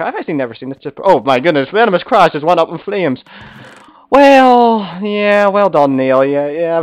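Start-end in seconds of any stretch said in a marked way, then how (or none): 4.1-6.2 mock congratulations. Real, not acted. Mocking a friend who died first.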